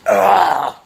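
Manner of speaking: loud, high "ah" of exertion; strained effort groan. Real, not acted. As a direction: let out a short groan of effort. Effort getting out around the lift.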